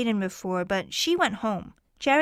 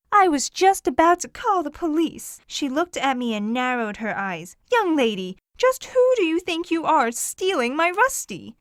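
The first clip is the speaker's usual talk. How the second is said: indignant; 4 semitones higher, 4 dB louder than usual. Acted. Old lady accusing, indignant.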